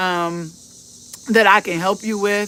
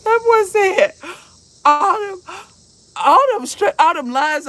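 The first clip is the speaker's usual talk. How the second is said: high, tickled retelling through laughter; real helpless laughter; 9 semitones higher than usual. Real, not acted. Mother retelling her son's blunt remark, helplessly laughing. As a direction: struggle to get the words out for laughing. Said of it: "I couldn't do nothing but laugh".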